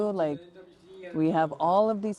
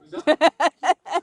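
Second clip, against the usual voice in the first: loud laugh; 11 semitones higher, 11 dB louder than usual. Real, not acted. Laugh after joking line.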